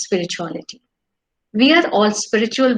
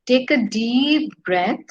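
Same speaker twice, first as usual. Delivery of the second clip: guided meditation. Acted. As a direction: lead the breathing calmly.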